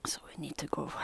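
soft, breathy whisper. Real, not acted. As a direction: hush it to the dog. Whispering to dog.

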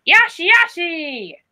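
drawn-out creature catchphrase; Yoshi call. Acted. Dinosaur who cannot roar, calling its Yoshi cry. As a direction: call it out like a little dinosaur character. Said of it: "Even if I cannot roar".

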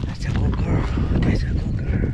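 whispered praise to a worn-out dog. Real, not acted. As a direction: breathe the praise gently, unvoiced.

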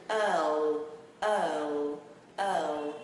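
EARL drawn out, low, ending in a dark L; low, stretched demo of EARL; tongue up for L. Shown as a demonstration. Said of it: "Quánh chữ L lên nha".

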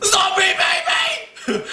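very high, drawn-out scream; mock terror. Man pretending he found a zombie baby, mock-terrified shriek. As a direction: shriek it in joke panic.

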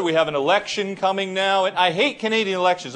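ordinary talk, nothing marked

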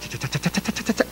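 soft, rapid run of "cha" sounds; flicking-through-letters noise. Retold or imitated. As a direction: imitate leafing quickly through envelopes, very softly. Imitates flicking quickly through the letters. (sonido suave de pasar cartas rápido)

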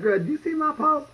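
high, girly mock voice of a needy friend; mimicking a friend. Mimicking a friend asking about her Facebook post in a high voice. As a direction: squeak it up like a friend begging for likes. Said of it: "They be like".

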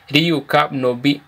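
ordinary talk, nothing marked

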